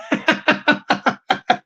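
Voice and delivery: high wheezy laugh tailing off; helpless giggling at his own idea. Real, not acted. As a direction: burst out in a long, high cackle.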